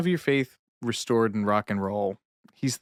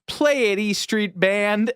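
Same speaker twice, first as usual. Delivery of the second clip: put-on little girl's command; 10 semitones higher, slower than usual. Little girl commands the band.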